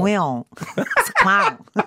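giggling through a Parisian "on"; laughing parisian accent. Shown as a demonstration. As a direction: laugh while trailing "on". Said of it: "Il y a un on à la fin".

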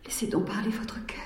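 soft coaxing whisper. Acted. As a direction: whisper it gently. Whispered urging to king.

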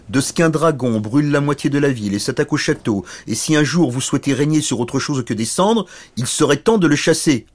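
a touch of royal exasperation; irate king. Acted. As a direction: press a lazy son-in-law to act. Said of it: "Le roi, furieux".

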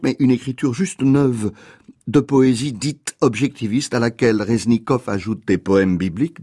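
ordinary talk

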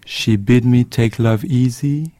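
very slow, measured poem reading; poem recitation. Acted. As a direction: recite the verse slowly, lingering on each phrase. Reads Yeats poem aloud. (récitation lente d'un poème)

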